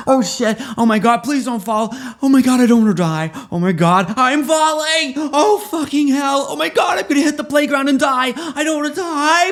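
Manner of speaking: high, loud screaming panic; hammed-up screaming fall. Aladdin falling off his carpet toward the playground, screaming. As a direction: shriek like you're plunging to your death.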